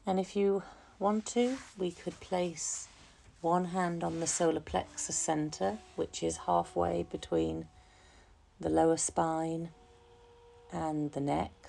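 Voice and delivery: calm, unhurried meditation voice, softening gradually; guided meditation. Real, not acted. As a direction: guide listeners gently into relaxation, leaving long pauses. Leads a relaxation meditation.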